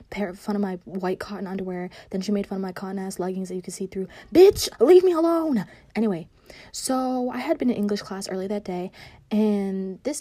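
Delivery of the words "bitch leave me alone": high, loud, drawn-out comeback; exasperated outburst. Acted. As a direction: tell off a bully, high and loud. Snaps back at her mocker.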